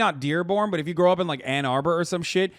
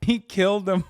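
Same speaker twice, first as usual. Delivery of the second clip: ordinary talk, nothing marked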